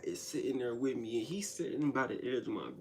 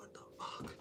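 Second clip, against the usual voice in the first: whisper; 10 semitones lower, 12 dB quieter than usual. Retold or imitated. Alone, scared, muttering to self.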